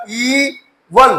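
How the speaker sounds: "ई वन" very slow, ee then van; separate long E. Shown as a demonstration. Teacher demonstrating long E, slow "ई", gap, "वन". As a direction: draw out "ई", pause, then "वन". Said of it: "ई अपनी long sound देगा".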